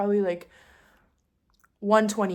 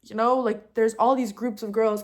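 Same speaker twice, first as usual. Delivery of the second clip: usual speaking voice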